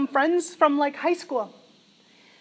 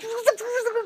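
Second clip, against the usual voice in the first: burst of laughter; 7 semitones higher than usual. Real, not acted. Laughing recounting hysterical vision.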